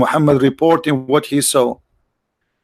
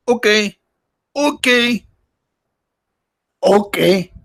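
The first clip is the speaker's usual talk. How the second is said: Filipino-style "okay", pitched much higher and sung out; 7 semitones higher, slower than usual. Retold or imitated. Mocking chat, imitating how Filipinos say okay, high and sing-song. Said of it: "This is how they say it in the Philippines".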